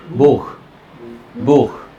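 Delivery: बुख with a long u and a breathy kh; German buch. Shown as a demonstration. Teacher corrects students' short बुख. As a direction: hold the u in बुख, end in a rasping kh. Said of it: "जैसा sound है वैसे बोलो".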